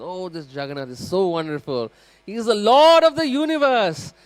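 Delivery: rising high and loud into rapturous praise of the Lord; adoring praise. Acted. Temple priest praising Jagannath to pilgrims, rapturous and high. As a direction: lift the voice up in awe, as if announcing wonders. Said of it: "he's narrating the pastimes of Jagannath".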